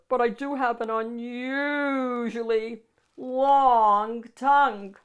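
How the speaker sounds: slow, loud slurpy monster boast; slurping monster. A narrator's reading. Monster boasts to the child about his tongue, slow and slurping. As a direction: drag it out like a drooling beast. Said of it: "Max slurped".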